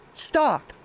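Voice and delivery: high, sudden "Stop!"; playful imitated halt; mock stop command. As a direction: break the routine like a traffic cop. Someone interrupts the line.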